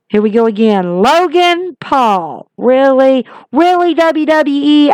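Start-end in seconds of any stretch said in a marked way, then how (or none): 2.6-4.9 higher, drawn-out fed-up disbelief; exasperated. Real, not acted. Vlogger scolding WWE over Logan Paul, fed up. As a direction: roll your eyes at the company.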